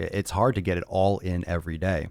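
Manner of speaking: ordinary talk, nothing marked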